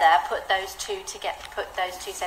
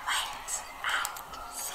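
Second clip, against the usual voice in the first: whisper; 13 dB quieter than usual. Shown as a demonstration. Teacher showing the pause words, whispered. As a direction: breathe it like a secret. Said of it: "in a whisper voice".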